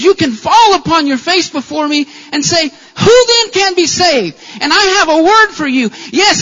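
loud, driving pulpit voice, building to a shout; full-force preaching. Acted. Pastor calling a young man to grace, preaching loudly. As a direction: preach it out to the whole room.

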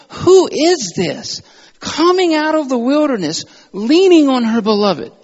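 high, loud cry of amazed wonder; awestruck. Acted. Writer seeing her beloved come from the wilderness, in awe. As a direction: gasp it out like you can't believe your eyes. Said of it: "you can hear the awe in her voice".

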